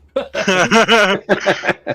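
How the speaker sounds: a loud, high burst of real laughter. Real, not acted. Player laughs after bragging about compliments, loud and high. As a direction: crack up at your own joke.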